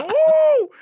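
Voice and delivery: loud, high whoop of triumph; excited whoop. Real, not acted. Bragging to friends about the club, loud whoop. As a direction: whoop it out like a winner. Celebrating his own brag.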